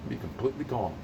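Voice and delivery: slow, soft and settled; calm slowed voice. Shown as a demonstration. Calming the caller down, slow and soft. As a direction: ease right down to calm. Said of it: "I'm not like this all the time".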